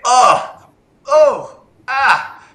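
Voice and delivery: high-pitched imitated yelps of discomfort; mock pained noises. Imitating a friend's constant noises on camel-back, mock pained yelps. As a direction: mimic a moaning passenger with high, sharp ohs and ahs. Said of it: "making noises constantly".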